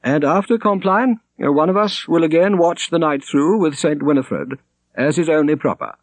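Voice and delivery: high, formal churchman's voice; the prior. A narrator's reading. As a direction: voice the prior as a high, stately cleric. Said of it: "said the prior".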